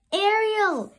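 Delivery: high, loud urgent call; cried out. A narrator's reading. Fish calling to Ariel for help, loud cry. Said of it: "he cried".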